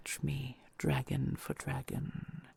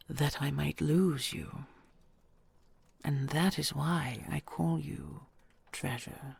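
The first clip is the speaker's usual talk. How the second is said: usual speaking voice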